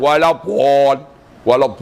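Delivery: "Walad" with a rounded Javanese ending; Javanese accent. Shown as a demonstration. Teacher mimicking how many people misread it, Javanese accent. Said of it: "tu Jawa".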